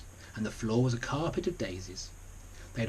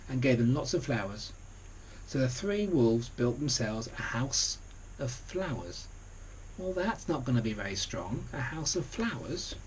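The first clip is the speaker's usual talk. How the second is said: plain reading voice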